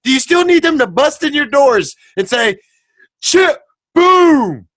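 loud, high, slow ranting; high loud exasperated rant. Real, not acted. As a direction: shout the frustration at them. Ranting at people.

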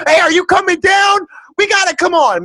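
high, excited holler; hyped-up urging. Pretend. Fan urging friend to come down, hyped-up holler. Hyped plea about ICP event.

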